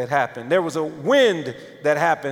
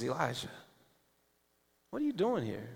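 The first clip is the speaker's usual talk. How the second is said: much softer, near a murmur; gentle voice of God; 4 semitones lower, 14 dB quieter, faster than usual. Acted. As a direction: call his name tenderly, barely above a breath. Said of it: "God gently says".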